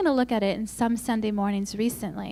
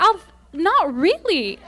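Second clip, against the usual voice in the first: laughing refusal; 8 semitones higher, 7 dB louder, slower than usual. Retold or imitated. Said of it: "And I'm like".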